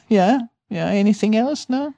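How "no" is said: usual speaking voice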